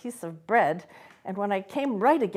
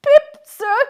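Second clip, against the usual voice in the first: much higher and louder, scared; frightened boy; 14 dB louder than usual. A narrator's reading. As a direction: squeak out your name in fear. Boy answers in fear.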